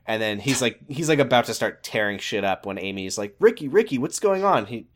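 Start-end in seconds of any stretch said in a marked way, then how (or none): 3.4-4.7 high anxious pleading voice; worried girlfriend. Retold or imitated. Amy worried, retold scene.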